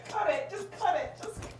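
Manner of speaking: real laughter through the words; high voice, breaking up with laughter; laughing. Real, not acted. Cracking up during a take.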